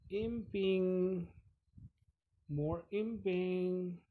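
high, loud, drawn-out announcement; playful calling out. Pretend. Player turning zombies into imps, gleeful drawn-out call. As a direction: stretch each word out high and loud.

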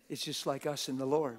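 usual speaking voice

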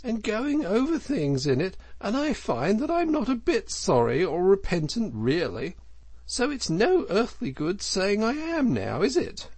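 high, animated, gushing self-justification; flatter, then brazenly take it all back; unrepentant Toad. Acted.

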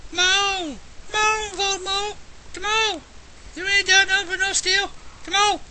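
high pitched hyped shouting; frenzied taunting yell. Real, not acted. Gamer screaming taunts at Voldemort, high-pitched and frenzied. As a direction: egg the boss on at full cry.

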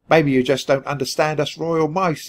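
ordinary delivery, nothing marked

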